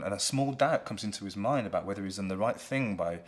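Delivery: ordinary delivery, nothing marked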